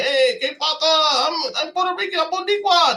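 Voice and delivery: high, laughing put-on voice claiming to be Boricua; hammed up, giggling through a fake Puerto Rican boast; mock Puerto Rican claim. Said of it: "be like".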